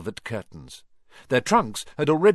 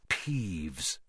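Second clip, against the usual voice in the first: hushed, much softer naming of the ghost; whispered; 7 semitones lower, 9 dB quieter than usual. A narrator's reading. Percy warning the first years, hushed and soft. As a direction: lean in and breathe the name low. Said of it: "Percy whispered to the first years".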